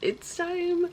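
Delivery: high-pitched retold "it's time"; sudden high bright self-pep; mock psyched-up. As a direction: squeak it out, psyching yourself up. Retold self-talk at the birth.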